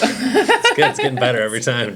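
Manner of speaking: laughing through the words; can barely get it out for laughing; teasing a cohost while laughing. Real, not acted. Laughing at the stumbling.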